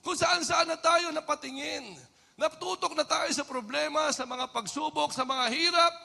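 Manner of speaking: impassioned preaching. Acted. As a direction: press the point on the congregation. Preaching at full force. (masidhing pangangaral)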